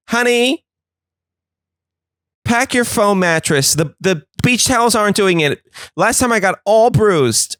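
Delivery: a high-pitched fussy mother bossing her husband around; fussy mom voice. Acted. Mom nagging dad at cement beach.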